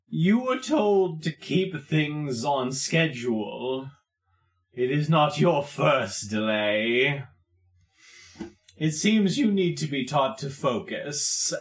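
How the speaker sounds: slow, deliberate villain reprimand; menacing Wallace. Acted. Wallace scolds a man over delays, slow and menacing. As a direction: lecture the underling with measured menace.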